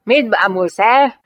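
loud, drawn-out challenge; angry snap. A narrator's reading. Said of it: "ráförmett a lányra".

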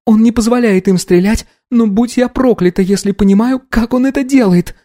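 high female character voice; focused young woman. Acted. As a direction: report intently in a high voice.

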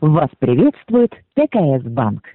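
ordinary talk, nothing marked

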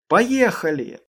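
loud, excited shout of "let's go". A narrator's reading. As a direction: call out loudly to start the ride. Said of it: "крикнул Дэйв".

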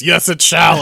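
loud, booming pronouncement; voice from above. Acted. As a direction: thunder it out like a voice from the heavens. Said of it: "And a voice says".

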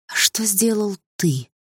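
a menacing sweetness; tender. A narrator's reading. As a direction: ask it with false tenderness. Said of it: "спросила я нежно".

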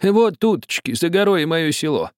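put-on high, eager old villager; old man pointing. A narrator's reading. Old man showing the captain his village, high and eager. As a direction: point him home with a coaxing lift. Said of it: "ответил старик".

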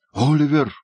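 high-pitched cry of a name; Феджин calling out. A narrator's reading. Condemned man beckoning the boy over, calling out. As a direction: call the boy over urgently. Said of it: "крикнул Феджин".

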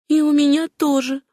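short, high-pitched cut-off reply; curt retort. A narrator's reading. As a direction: cut her off briefly. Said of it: "коротко отрезала Сюзанна". (коротко, отрезая)

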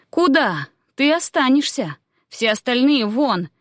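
high, imperious order; commanding. A narrator's reading. Queen halting druid and dismissing others, imperious command. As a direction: stop him and send the others out.

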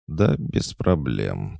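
slow breathy purr. Acted. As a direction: purr it out lazily. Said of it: "промурлыкала ведьма-младшая".

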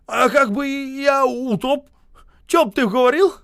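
high, loud scolding question; indignant retort. A narrator's reading. Боев scolding penitent Осип, high indignant shout.

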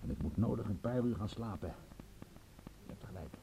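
soft, fading into a whisper; drained, sleepy murmur; worn out. Acted. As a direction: let the voice sink away as sleep takes over. Exhausted, about to go to sleep.

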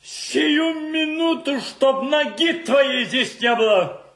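high furious shout easing into a threat; furious ejection. Acted. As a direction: roar him out of the house, then threaten. Throws the man out with threats. (яростный крик, переходящий в угрозу)

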